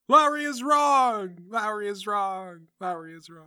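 shouted echo fading away; slow shout dying off like an echo; cave echo. Acted. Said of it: "shouts into the cave".

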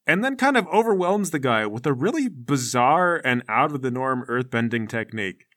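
usual speaking voice

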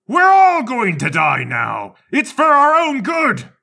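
slow, high, loud deranged yell; hammed-up madman bellowing; manic shout. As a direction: scream it like a zealot torching the place. Madman about to burn house.

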